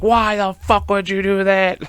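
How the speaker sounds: high, slow strained yell without full volume; imitated drawn-out high outburst; mock scream. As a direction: act out screaming at grandma, held back. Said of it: "screaming at her".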